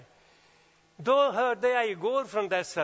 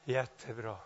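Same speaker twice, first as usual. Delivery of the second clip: small inner voice; 7 semitones lower, 5 dB quieter than usual. Retold or imitated. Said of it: "en liten röst där inne och säger".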